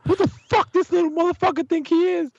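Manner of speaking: very high-pitched indignant rant; retold hot outrage. Dad rants about the kid who cursed at his son, outraged. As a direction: re-enact an outraged outburst, voice shot way up. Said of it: "So I'm hot".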